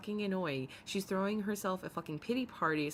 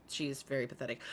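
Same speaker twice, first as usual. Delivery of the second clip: usual speaking voice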